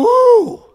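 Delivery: high, loud whooping woo; excited woo. Acted. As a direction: let out a big excited whoop. Preacher acts excited cry.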